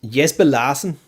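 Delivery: name said in a mock Danish accent. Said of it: "This is my best Danish accent".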